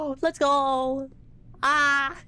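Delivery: high, loud, stretched-out cheer; excited shout. Real, not acted. Streamer cheering his lucky draw, loud and high. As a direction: yell with joy at the lucky find.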